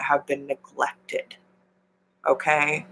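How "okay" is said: ordinary delivery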